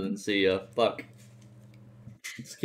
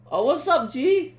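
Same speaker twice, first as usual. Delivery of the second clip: excited greeting; 5 dB louder, slower than usual. Real, not acted. Greets friend on voice chat.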